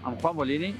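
ordinary delivery

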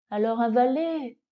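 ordinary delivery, nothing marked

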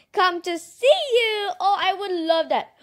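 giggly, breaking into laughter; delighted giggly gush; laughing with delight. Real, not acted. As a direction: laugh through the words. Delighted at magical butterflies.

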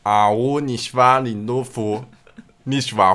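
ordinary talk, nothing marked

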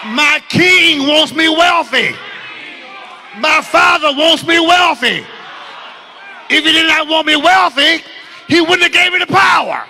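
preacher modelling conviction for a nonchalant crowd, shouting; bold shout. Shown as a demonstration. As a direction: proclaim it like a conquering heir. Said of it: "y'all are still too nonchalant".

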